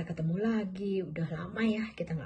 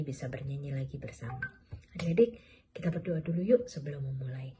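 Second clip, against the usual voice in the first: usual speaking voice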